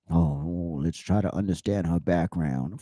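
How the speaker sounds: mock sympathy. Host mocking a commentator's sympathetic plea, sarcastically soft. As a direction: mimic a syrupy plea to understand.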